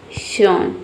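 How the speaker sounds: शन drawn out slowly like the श of शलजम; model pronunciation. Shown as a demonstration. Teacher modelling the -tion ending शन slowly for students. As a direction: stretch शन slowly with a clear sh. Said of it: "इस तरीके से शन pronounce करते हैं".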